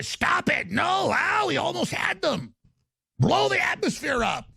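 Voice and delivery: high, shrieking cartoon-villain panic; mocking villain yell, pitched high; mock villain shout. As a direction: screech like a foiled cartoon villain.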